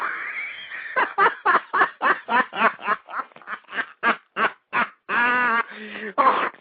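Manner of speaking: long, rising fit of laughter; can't stop laughing; long helpless laugh. Real, not acted. Keeps laughing after apology.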